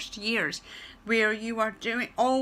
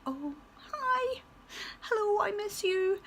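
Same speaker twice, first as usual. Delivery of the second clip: much higher and softer, gushing; fond greeting; 11 semitones higher, 6 dB quieter than usual. Retold or imitated. Teacher telling pupils she misses them, soft high gush. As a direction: coo it tenderly to missed pupils. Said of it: "that's like".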